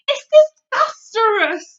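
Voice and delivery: loud, slow gurgle through a mouthful; gurgling. Acted. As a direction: gurgle it out in disgust. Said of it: "the B F G gurgled".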